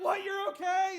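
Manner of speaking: high, loud disbelieving call; astonished shouting. Retold or imitated. King checks on Daniel in the den, astonished shouting. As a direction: yell it down in amazement.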